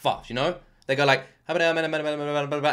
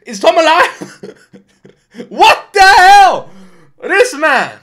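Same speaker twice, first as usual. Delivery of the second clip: amazed shouting; 13 semitones higher, 16 dB louder, much slower than usual. Real, not acted. Stunned reaction to long verse.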